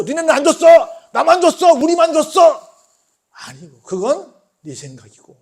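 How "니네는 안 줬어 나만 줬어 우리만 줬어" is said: high, loud mock shouting; mock possessive boasting. Preacher mimics people claiming God's gift as theirs, loud boasting. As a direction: crow it out, shutting the others out.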